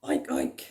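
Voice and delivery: low grunting oink; pig noise. Pretend. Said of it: "making the animal noises".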